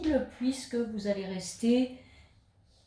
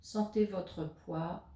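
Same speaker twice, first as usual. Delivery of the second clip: guided meditation; 3 dB quieter than usual. Acted. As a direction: guide the listener into stillness, leaving space between phrases. Guides a yoga nidra session. (voix posée de méditation guidée, avec de longues pauses)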